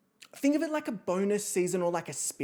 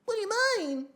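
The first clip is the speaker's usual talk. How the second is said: very high put-on child voice; hammed-up child-star baby voice; baby impersonation; faster than usual. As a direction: squeak it out like a creepy little girl. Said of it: "doing an impression of Shirley Temple".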